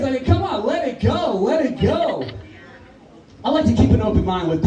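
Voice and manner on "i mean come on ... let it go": ordinary talk, nothing marked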